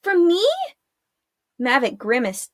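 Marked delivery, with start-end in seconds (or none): 0.0-0.4 put-on high, anxious girl's voice; a frightened girl pleading with her friend; worried young girl. A narrator's reading.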